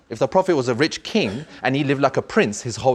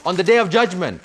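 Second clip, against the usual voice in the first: impassioned preaching; 6 semitones higher than usual. Acted. Preaching with rising force.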